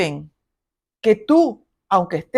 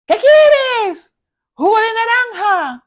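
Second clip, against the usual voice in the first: much higher, louder exasperated snap; irritated mother; 11 semitones higher, 5 dB louder, slower than usual. Retold or imitated. Annoyed mother barking at child for juice, high loud irritation. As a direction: snap back at the child, fed up. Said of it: "tenemos las madres de".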